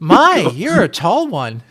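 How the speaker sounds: impressed. Acted. Character remarks on height.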